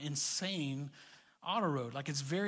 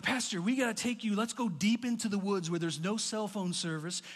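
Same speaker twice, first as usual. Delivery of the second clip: mock eager pitch; 4 semitones higher than usual. Friends pitch a wilderness trip.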